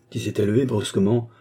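ordinary delivery, nothing marked

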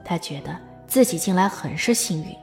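usual speaking voice

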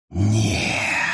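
sharp low hissed cry. A narrator's reading. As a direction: hiss it out in horror. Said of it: "прошипел он". (прошипеть в ужасе)

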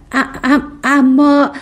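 halting false starts before the word comes out; stammering. A narrator's reading. Taken aback Riket answers the princess, stammering. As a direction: stumble on the first sound, then push it out. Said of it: "با لکنت گفت".

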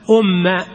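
أم said umma, u then doubled mim with a; vowelling of أم. Shown as a demonstration. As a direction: model أم as umma, ending on fatha. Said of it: "ضع على أم الهمزة ضمه".

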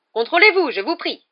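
high-pitched loud reprimand; indignant. A narrator's reading. Matron ordering the professor to control himself, indignant. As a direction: start very high and loud, then ease off. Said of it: "s'indigna madame Pomfrey".

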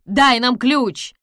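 slow, loud, high demand; exclaimed. A narrator's reading. Hendrick demands the key, exclaiming. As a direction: shout for it, leaning on each word. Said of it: "воскликнул Хендрик".

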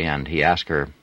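ordinary delivery

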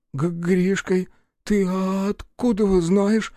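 put-on high, slow, stammering child voice; surprised boy. A narrator's reading. Freezing boy Гришка asks Рух in a high stammer. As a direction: stutter it like a frozen boy. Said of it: "удивился парнишка".

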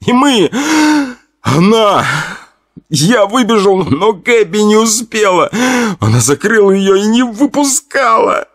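very high, choked cry; sobbing. Acted. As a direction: sob through each word. Tommy sobbing the fire story.

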